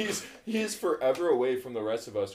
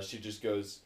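usual speaking voice

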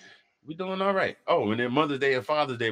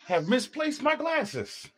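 mock dramatic; 13 semitones higher, much slower than usual. Slow high comic line.

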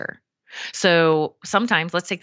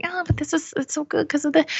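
high, softer pleading excuse; out-of-alignment voice; 10 semitones higher, 3 dB quieter than usual. Shown as a demonstration. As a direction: waver up high, bargaining with yourself. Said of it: "a little bit in scarcity".

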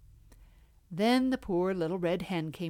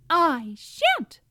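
high squeaky little mouse; tiny squeaky critter; 10 semitones higher, 8 dB louder than usual. Acted.

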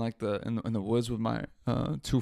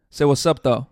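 louder call across the room; real call-out, louder; 8 dB louder than usual. Real, not acted. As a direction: shout it over to the phone. Calling friend to speak on podcast.

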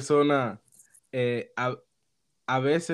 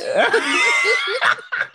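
high shrieking burst of laughter; real laughing outburst; loud laugh; 4 dB louder than usual. Real, not acted. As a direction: break into a big laugh.

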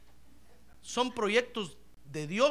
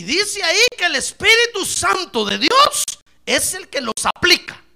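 fervent preaching; 10 semitones higher, 14 dB louder than usual. Acted. Preaching loud.

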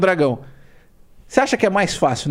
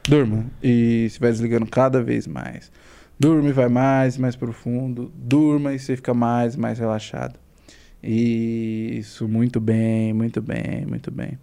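hypnotic induction; 6 semitones lower, much slower than usual. Acted. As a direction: lull them down into trance. Hypnotic deepening.

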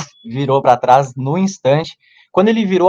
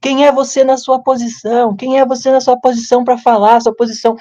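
mimicking the girl's shout; 9 semitones higher than usual. Girl in the bar yelling at him, high mock indignation. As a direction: squeal the challenge up high, indignant. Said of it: "a menina começou a gritar pra mim".

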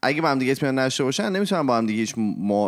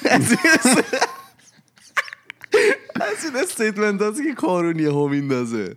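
laughing; 10 semitones higher, 3 dB louder than usual. Real, not acted.

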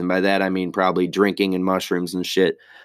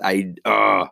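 ordinary delivery

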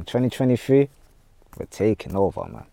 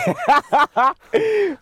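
high excited laugh; 5 dB louder than usual. Real, not acted.

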